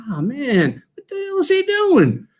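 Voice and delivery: exasperated high cry of what is he doing; retold panicked outburst from the sidelines; alarmed exclamation. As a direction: pitch jumps way up in disbelief. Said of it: "And I'm going".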